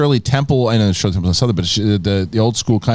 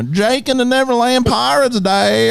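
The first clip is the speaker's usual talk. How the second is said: high, hammed-up Southern child; Southern accent; 11 semitones higher, slower than usual. Dad imitating his child choosing a show, Southern accent. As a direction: answer in a high Southern twang. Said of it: "what show do you wanna watch".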